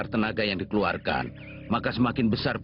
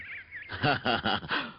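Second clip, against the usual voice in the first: soft acted chuckle; a short, quieter laugh to himself; 5 dB quieter than usual. Gatekeeper laughs after remark. (tawa kecil)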